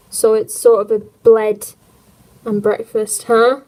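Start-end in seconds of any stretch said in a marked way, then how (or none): none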